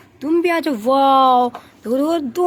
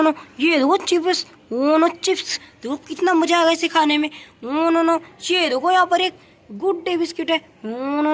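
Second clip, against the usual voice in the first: usual speaking voice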